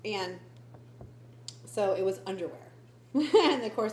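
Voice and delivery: normal talking voice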